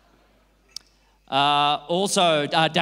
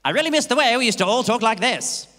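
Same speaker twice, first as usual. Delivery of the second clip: put-on old-timey speaking; 6 semitones higher, 4 dB louder than usual. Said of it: "that old-timey way of speaking".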